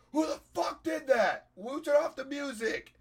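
high, loud outraged heckler; angry crowd shout. Retold or imitated. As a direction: yell it like an indignant crowd.

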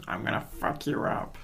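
high, hushed villain parody; soft mock threat in a put-on game-boss voice; Wart impression. Co-host inventing a game boss's line, hushed mock menace. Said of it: "Wart says".

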